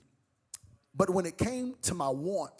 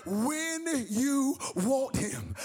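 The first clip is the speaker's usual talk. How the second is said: preaching; 6 semitones higher, slower than usual. Acted. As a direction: stretch every word to the congregation. Preaching climax.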